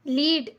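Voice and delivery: लीड with a drawn-out long ee; wrong long vowel. Shown as a demonstration. Teacher warning pupils against a long ee in लीड. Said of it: "ऐसे नहीं पढ़ रहे हैं".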